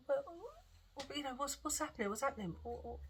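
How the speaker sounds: high, soft, stammered questions; retold flustered worry. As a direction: imitate yourself anxiously fumbling for words. Retells her flustered, worried questions.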